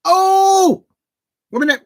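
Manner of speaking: high loud burst of excitement; excited shout. Real, not acted. Watching the match live, loud excited cry. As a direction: yell at the near miss.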